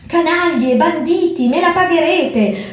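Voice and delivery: high-pitched angry chimp shriek; furious shriek. A narrator's reading. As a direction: screech the threat up high like an outraged monkey. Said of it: "strillò lo scimpanzé". (strillo furioso e acuto da scimpanzé)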